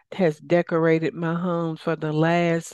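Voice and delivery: usual speaking voice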